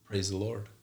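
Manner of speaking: softly voiced imitation of a whisper; retold whisper. Mother quoting her waking patient's first words, a soft retold whisper. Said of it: "she just whispered".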